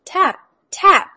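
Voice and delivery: high, loud knock imitation; knocking sound. A narrator's reading. Knocking at the door.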